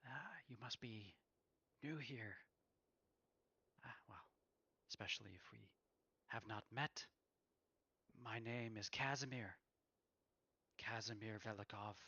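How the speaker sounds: put-on soft, hushed elvish accent; a gentle elvish accent, almost murmured; Casimir's soft elvish accent. A narrator's reading. Weary wizard introducing himself to a stranger, soft elvish accent. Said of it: "in a very soft tone, but in a very elvish accent".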